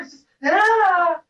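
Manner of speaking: long, high, slow open ah of release; a slow, high moan with no words; wordless ecstatic wail. Shown as a demonstration. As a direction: let a drawn-out cry pour out of you. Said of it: "it's not a word".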